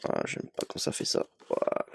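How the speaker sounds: quick, soft, breathy grumble to himself; annoyed aside, almost whispered; muttered under breath. Real, not acted. Grumbling to himself while wrestling open the packaging, muttered.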